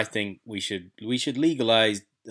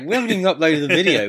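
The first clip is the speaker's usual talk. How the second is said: bewildered; 7 semitones higher, 7 dB louder, faster than usual. Retold or imitated. Shocked reaction to strange comment.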